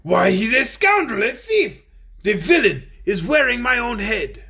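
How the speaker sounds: loud, high outrage; provoked. Acted. Tin Soldier denouncing the thief, loud outrage. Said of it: "now very much provoked".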